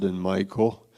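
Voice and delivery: usual speaking voice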